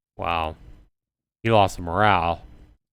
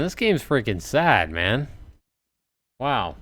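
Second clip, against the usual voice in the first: ordinary delivery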